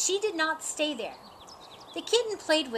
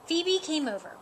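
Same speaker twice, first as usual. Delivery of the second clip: ordinary delivery, nothing marked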